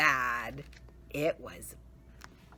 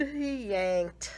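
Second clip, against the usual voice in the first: ordinary delivery, nothing marked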